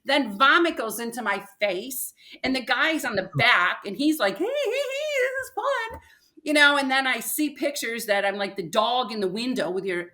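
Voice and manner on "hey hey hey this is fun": very high, mock-cheerful instructor voice; cheery instructor. Imitating the tandem instructor yelling over the fall. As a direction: squeak it out like the chirpy tandem guide.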